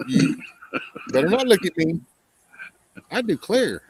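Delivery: high, put-on prissy sergeant; mock drill sergeant. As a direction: scold like a fussy sergeant. Mocking a soft drill sergeant.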